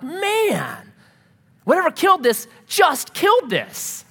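high, drawn-out "man" of alarm; alarmed realization. Retold or imitated. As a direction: realize it with a jolt, high and emphatic. Acted alarm at fresh kill.

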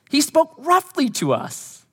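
high, slow, drawn-out whine on roughly; milk roughly like a sulking child; whiny tattle. Acted. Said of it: "your own sense of humor that's quite warped".

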